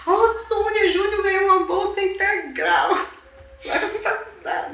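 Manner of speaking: high, excited shout to a friend; retold joy. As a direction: call out the good news, voice soaring. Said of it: "Eu fiquei muito feliz". (grito de alegria, recontado)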